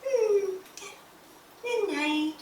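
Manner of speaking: mother mimicking her son saying good night, soft and high; imitating her son. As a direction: coo it like a drowsy child drifting off. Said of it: "this is how he falls asleep".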